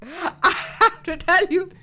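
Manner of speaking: laughing it out, high and loud; giggling while admitting it. Real, not acted. Laughing at own confession.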